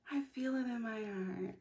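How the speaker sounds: tender soft repeat; dreamy hush. Shown as a demonstration. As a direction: say it softly to yourself.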